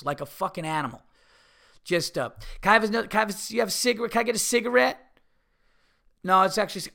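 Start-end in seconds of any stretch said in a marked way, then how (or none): 2.6-5.1 high, pushy mock voice; mocking rude stranger. Comedian mocks the woman begging for a cigarette, high and grabby. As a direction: parody the grabby asking. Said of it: "She had no fucking manners".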